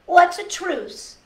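put-on curious child voice. Child asks grandmother question.

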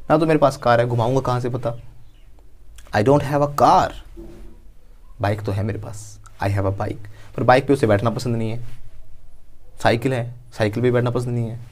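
normal talking voice

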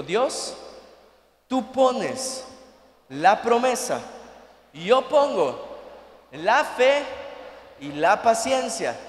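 slow, emphatic phrases with pauses; preached declaration. Shown as a demonstration. Preacher leads the congregation in a repeated prayer, emphatic declaration.

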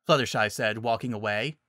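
ordinary delivery

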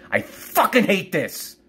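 high, drawn-out angry outburst; real frustrated fury; angry loathing. Real, not acted. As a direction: stretch it out, voice jumping up with hate. Repeats it, escalating.

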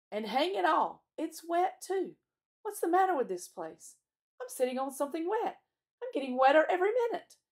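high, loud grumbling, rising in irritation; exasperated complaint. A narrator's reading. Peter grumbles about sitting in the wet, exasperated. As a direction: complain loudly, pitch pushed up.

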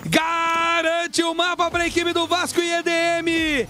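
high, drawn-out victory call; fighting game announcer. Real, not acted. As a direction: stretch out the winning call. Caster calls map win.

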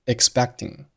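expecting with the k sound held back before t; expecting with a swallowed middle k; unreleased c. Shown as a demonstration. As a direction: stop the c in expecting without a burst. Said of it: "中间的字母c发的爆破音同样失去爆破".

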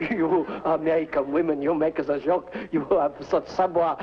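hammed-up fake French accent, flirting; mock French charmer with a bad accent. Mickey poses as Frenchman.

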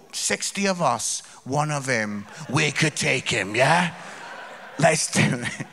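low conspiratorial sheep; plotting sheep. Pretend.

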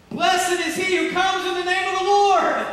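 high, ringing shout of praise; crowd shouting. Acted. Preacher acts people hailing Jesus, shouting. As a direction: cry it out like a welcoming crowd. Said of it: "All these people that were shouting".

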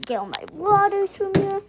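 ordinary talk

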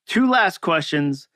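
normal talking voice

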